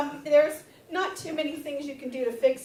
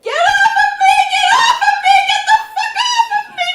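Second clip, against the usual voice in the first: frantic high scream; hammed-up panicked scream; 14 dB louder than usual. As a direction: shriek it in his ear in panic. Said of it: "I screamed in his ear".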